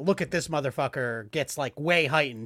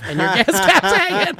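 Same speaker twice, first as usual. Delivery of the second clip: laughing; 14 semitones higher, 9 dB louder than usual. Retold or imitated. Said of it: "And he was like".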